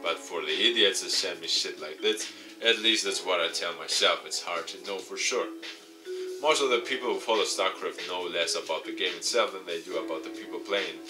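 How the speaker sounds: ordinary talk, nothing marked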